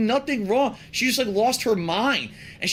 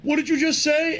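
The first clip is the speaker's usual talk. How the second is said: higher, louder accusing teacher; 7 semitones higher, 3 dB louder than usual. Retold or imitated. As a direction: demand it like a suspicious teacher. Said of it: "she says".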